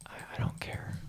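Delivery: unvoiced confiding whisper. Real, not acted. Telling viewers a secret about not caring, whispered. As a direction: lean in and whisper it. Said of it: "between you and me".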